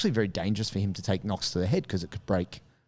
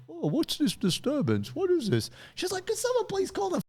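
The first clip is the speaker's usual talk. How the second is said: put-on high, prim old-lady fretting; fussy old neighbours. Elderly neighbours with coffee fretting at the fight, high and prim. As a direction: clutch your coffee and fret. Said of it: "old people coming out, holding their coffees".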